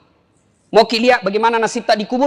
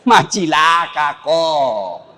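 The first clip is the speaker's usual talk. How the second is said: drawn-out scornful remark; 7 semitones lower, much slower than usual. Acted. Preacher mocks worldly success.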